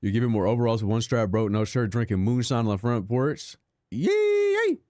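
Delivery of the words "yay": very high squeaky yay; mock cheer. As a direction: shriek it like a gleeful hillbilly. Sudden squeal.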